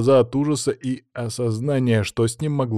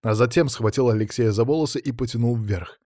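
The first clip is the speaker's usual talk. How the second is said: ordinary delivery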